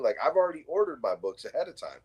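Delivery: ordinary talk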